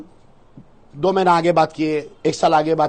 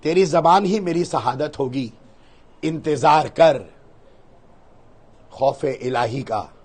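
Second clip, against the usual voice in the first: solemn recitation; 6 semitones lower, slower than usual. Acted. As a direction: declaim a slow vow, dropping to a hush. (धीमे, गंभीर शायराना अंदाज़ में)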